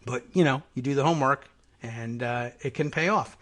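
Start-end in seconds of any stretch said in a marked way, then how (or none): none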